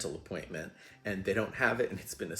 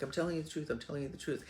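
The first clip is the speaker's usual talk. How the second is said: higher-pitched earnest plea; insistent, repeated assurance, pitched up; pleading insistence; 7 semitones higher, faster than usual. Retold or imitated. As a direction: imitate someone begging to be believed. Acted man insisting he is honest.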